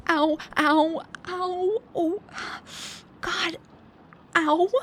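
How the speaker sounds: sharp high cries of pain, ow after ow; pained yelps. Acted. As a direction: yelp like the arrow just went in. Lands on an arrow, cries out.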